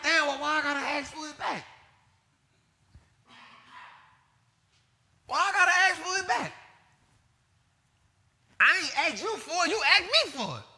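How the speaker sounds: loud, high, exasperated mock complaint; indignant complaint. Lender grumbling about having to ask a friend for his money back, loud and high. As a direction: throw up your hands at having to ask for it back. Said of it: "And then I'll be like".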